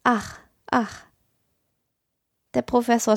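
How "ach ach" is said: sighing. A narrator's reading. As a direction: sigh it out like a doll. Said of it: "Olympia seufzte bloß immer wieder".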